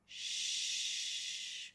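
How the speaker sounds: long unvoiced "sh"; shushing sound. Shown as a demonstration. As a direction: say "sh" as if hushing someone. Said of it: "just like you're asking somebody to be quiet".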